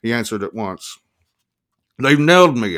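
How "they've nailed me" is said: loud, raised-pitch panicked shout. A narrator's reading. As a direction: shout it down the phone. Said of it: "I shouted".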